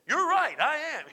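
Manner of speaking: high-pitched husband's comeback, imitated; hammed-up high concession; mock agreement. Husband cheerfully agrees, retold.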